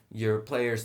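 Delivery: normal talking voice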